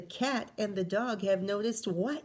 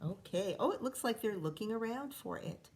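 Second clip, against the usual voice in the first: usual speaking voice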